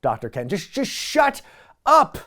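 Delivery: loud, fed-up shout of shut up; exasperated yell. Pretend. Viewer yells at the computer at an annoying sidekick, mock shout. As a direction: yell at the screen in mock frustration. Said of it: "I'm gonna be yelling at".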